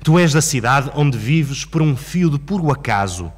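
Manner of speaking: measured, dramatic poetry reading with long pauses; poem recitation. Acted. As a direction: declaim it, swelling from hushed lines to forceful, driven lists. Recites Um Adeus Português.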